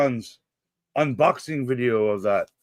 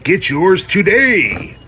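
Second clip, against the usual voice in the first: booming mock commercial announcer; 6 dB louder than usual. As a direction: sell it like a TV ad. Mock ad read for sponsor.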